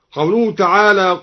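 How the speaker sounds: ordinary talk, nothing marked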